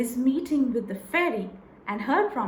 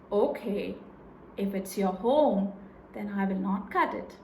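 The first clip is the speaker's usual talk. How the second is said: lower, steady woodcutter voice; gruff woodcutter; 5 semitones lower, 3 dB quieter than usual. Acted. Woodcutter agreeing to spare the fairy's home, lightly lowered voice. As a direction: give in kindly, a touch lower.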